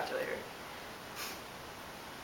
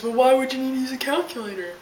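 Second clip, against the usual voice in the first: mocking the guy; 4 semitones higher, 4 dB louder, faster than usual. And the guy says.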